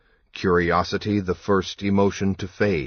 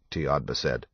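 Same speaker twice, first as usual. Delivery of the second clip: usual speaking voice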